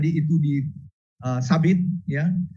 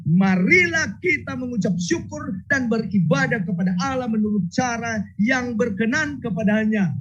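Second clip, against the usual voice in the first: fervent preaching; 7 semitones higher than usual. Acted. Preaching at full force.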